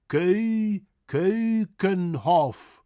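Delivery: "Keukenhof" very slow with a French-like EU; Dutch pronunciation. Shown as a demonstration. Teacher breaking the word down, very slow. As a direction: split "Keu-" then slow "Keukenhof". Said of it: "French word".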